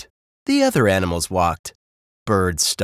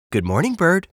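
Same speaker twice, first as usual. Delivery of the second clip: high-pitched cheery sheep greeting; high sheep character voice; friendly sheep; 8 semitones higher, faster than usual. Acted.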